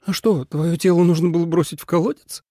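high, sarcastic question; caustic. A narrator's reading. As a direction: needle him with mock innocence. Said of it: "язвительно спросила Суэлань".

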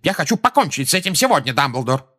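high, rushed, indignant outburst; exasperated fury. A narrator's reading. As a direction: burst out loud, then race through it. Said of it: "взбеленился Фадж".

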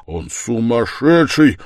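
loud, urgent stage whisper; fervent, forceful rebuke; heated whisper. A narrator's reading. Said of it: "горячо прошептал Разумихин".

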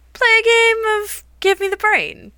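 high, bright sales pitch; commercial announcer voice. Pretend. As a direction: sell it like a TV ad. Pitching the game like an ad.

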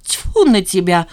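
loud, slow disgusted dismissal; heated retort. A narrator's reading. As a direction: spit it out in exasperation. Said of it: "в сердцах ответила Жанна". (в сердцах)

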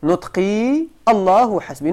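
ordinary talk

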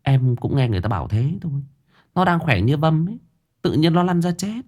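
put-on ex-girlfriend gossiping to him about the dead man; gossipy village girl. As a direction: dish the rumour like a village gossip.

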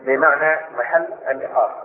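ordinary talk

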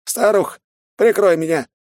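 put-on high loud old mage voice; old mage calling out. A narrator's reading. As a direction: bark an order to the old woman. Earth mage calls to ally.

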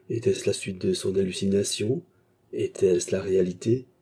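normal talking voice

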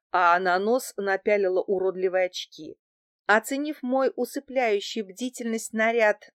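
ordinary delivery

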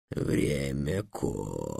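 low, slow, solemn dragon voice. A narrator's reading. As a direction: announce it gravely, dragging the words. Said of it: "торжественно сказал дракон". (торжественный низкий голос дракона)

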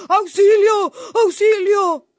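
loud, high panicked cries for help; hammed-up storybook panic; frightened fox. A narrator's reading. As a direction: yell for rescue in a frenzy. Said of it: "el pobre tío zorro se asustó".

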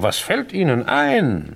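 empört, höher und langsam gesprochen. Retold or imitated. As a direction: spiel Emilys Entrüstung nach. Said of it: "Und sie war empört". (Emily empört nachgeahmt, langsam und höher)

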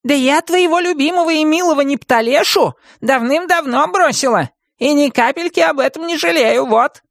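high-pitched witch outburst; indignant witch. Acted. Witch disowns her former beloved, indignantly. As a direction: protest hotly in a high witch voice.

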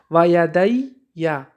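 یدی یا said very slowly, with the یا stressed; slow demo pronunciation. Shown as a demonstration. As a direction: lay out یدی and then یا. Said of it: "مثال گفته است که".

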